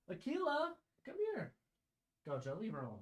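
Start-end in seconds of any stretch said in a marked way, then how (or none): 0.1-1.5 high sing-song voice for a puppy; pet talk. Real, not acted. Calling the little dog over, high coaxing pet voice.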